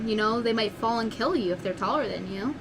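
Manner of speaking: normal talking voice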